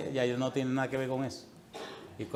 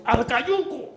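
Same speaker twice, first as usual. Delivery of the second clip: high, loud battle cry; gleeful shout; 11 semitones higher, 6 dB louder, much slower than usual. Retold or imitated. Boy yells to Pepe to toss the bully into thorns, loud cry. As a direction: yell the order to throw him in. Shouted order to throw him.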